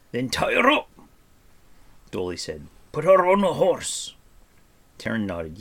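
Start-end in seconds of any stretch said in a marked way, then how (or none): 0.1-0.9 very high, loud exasperated shout; forceful, high-pitched yell; angry dwarf order. A narrator's reading. Angry dwarf orders Taryn about. 2.9-4.1 loud, higher-pitched command; exasperated, raised-voice order; angry dwarf order. A narrator's reading. Angry dwarf orders Taryn about.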